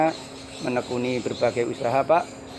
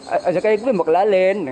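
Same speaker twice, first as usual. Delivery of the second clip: Tegal Javanese lilt, pitched higher and louder; lively Tegal dialect demo; Tegal accent; 6 semitones higher, 4 dB louder than usual. Shown as a demonstration. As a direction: show off the sing-song Tegal accent. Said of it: "Kalau Tegal logatnya seperti ini".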